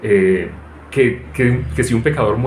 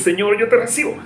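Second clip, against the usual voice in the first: mock prayer, much higher and tense; strained fist-clenched prayer; 9 semitones higher than usual. Preacher mocks believers clenching fists to pray, strained and high. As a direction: squeeze the words out like clenching fists. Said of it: "Apriete más los puños".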